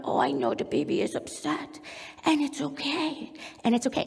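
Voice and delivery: soft, half-whispered sympathy; mirroring distress, soothing. Shown as a demonstration. Mom aligning with distressed infant, softly sympathetic. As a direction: commiserate gently with an upset baby. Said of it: "when my infant is distressed".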